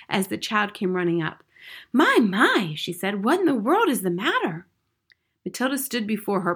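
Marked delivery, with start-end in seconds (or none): 1.9-2.7 raised, louder, lilting; surprised concern. A narrator's reading. Teacher startled by running child. 3.2-4.7 higher, lilting question; surprised concern. A narrator's reading. Teacher startled by running child.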